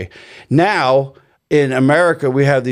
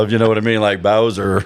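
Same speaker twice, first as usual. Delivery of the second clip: pitched much lower, deep growl; deepened voice; 7 semitones lower than usual. Shown as a demonstration. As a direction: push the voice way down low. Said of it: "so I try to deepen it down".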